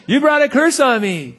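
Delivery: high, hammed-up grieving accusation; upset accusing mother. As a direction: imitate a distraught woman blaming the prophet. The lady's upset 'cause her son died.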